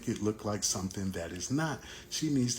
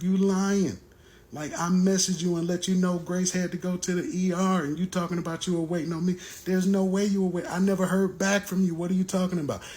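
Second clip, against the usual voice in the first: higher and louder, calling out the lie; heated accusation; 9 semitones higher, 4 dB louder, faster than usual. Retold or imitated. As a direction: jump in hard, furious at her. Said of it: "I jumped in on the conversation like".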